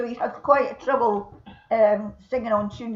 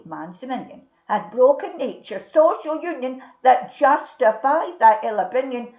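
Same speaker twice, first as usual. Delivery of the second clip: put-on Burns poem recited in a broad Scots accent; broad Scots recitation; 2 semitones lower than usual. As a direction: declaim it in thick Lowland Scots. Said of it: "best Scots accents I've heard tonight".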